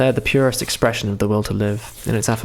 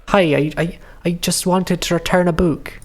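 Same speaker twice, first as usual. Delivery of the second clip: higher-pitched Welsh voice for a game character; Welsh accent; 7 semitones higher than usual. Acted. Lena returning a book, Welsh accent. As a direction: put on a Welsh lilt, up high. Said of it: "Oh, she was Welsh".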